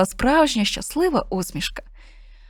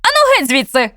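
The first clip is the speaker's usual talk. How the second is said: louder shooing snap; hissing at cat; 5 semitones higher, 6 dB louder than usual. Acted. Witch shoos the cat away, sharp and louder. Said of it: "шикнула на кота".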